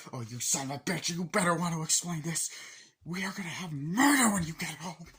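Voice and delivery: hammed-up threatening spouse; mock furious wife.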